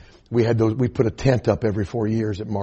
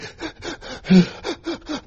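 mock gasp. Crying toddler brought out.

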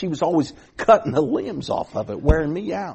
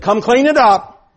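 stern order; 8 semitones higher, 6 dB louder than usual. Retold or imitated. Neighbor lady orders again.

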